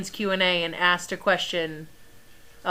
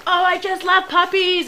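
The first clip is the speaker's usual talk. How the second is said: high, gushing puppy lover; mock gushing over a puppy; gushing visitor; 11 semitones higher, 4 dB louder than usual. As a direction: squeal it like someone smitten with puppies.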